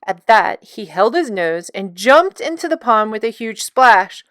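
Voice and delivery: usual speaking voice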